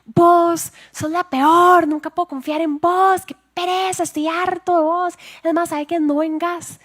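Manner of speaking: put-on high, loud, slow furious boyfriend shout; reenacting the boyfriend's high-pitched screaming tirade; retold angry yelling. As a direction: mimic him yelling at her, high and loud. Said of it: "me ha dado esta gritada".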